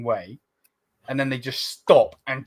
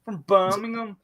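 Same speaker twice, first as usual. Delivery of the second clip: high-pitched Brummie mimicry; mock Brummie accent; 9 semitones higher than usual. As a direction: put on an annoying Brummie accent. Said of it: "Their accent's annoying".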